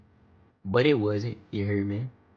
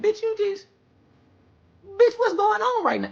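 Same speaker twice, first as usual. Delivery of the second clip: high squeaky outraged disbelief, much higher; exasperated disbelief; 4 dB louder than usual. Retold or imitated. Boy to girl who left the door open, high outraged squeak. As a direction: squeal at her like she's lost her mind. She returns casually, he freaks.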